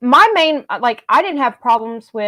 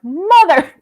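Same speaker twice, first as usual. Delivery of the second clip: a higher, louder burst at the screen; excited exclamation; 7 semitones higher, 5 dB louder than usual. Retold or imitated. Fan in the theater reacting to the ending, with an excited louder high cry. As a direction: blurt it out in excitement.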